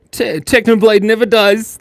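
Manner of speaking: high and loud rallying cry; high loud chant; loud meme shout. Acted. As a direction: belt out the meme line.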